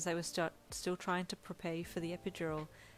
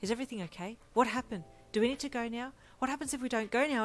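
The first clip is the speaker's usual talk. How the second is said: rising worried voice, louder; anxious questions; 5 semitones higher, 6 dB louder, faster than usual. Acted. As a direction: rush the questions in alarm. Worried partner asks about labour.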